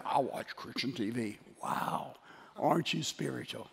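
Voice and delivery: sarcastic, hushed fake admiration; softly mock being impressed, breath out the wow; mock pious awe. Preacher mocking self-satisfied TV watchers, sarcastic mock awe.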